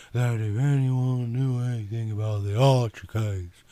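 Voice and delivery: slow Southern drawl, lingering on words; lazy Southern woman explaining. Acted. Yvonne tells the detective why she came, in a Southern drawl.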